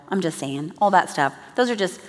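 ordinary talk, nothing marked

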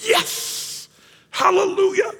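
breathy yes then a sky-high hallelujah; hammed-up rejoicing old priest; high mock cheer of an old priest; mock excited cheer. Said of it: "Is he gonna go".

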